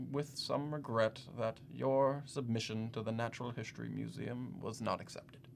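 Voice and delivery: soft, halting delivery of bad news; mildly regretful. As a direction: break it to them gently, trailing off. Breaking bad news of rejection.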